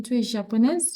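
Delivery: JAPONAISE going up at the end, as a question; rising intonation. Shown as a demonstration. French teacher shows learners intonation going up, questioning tone. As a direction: lift the pitch on "japonaise" to ask. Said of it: "So your intonation goes up".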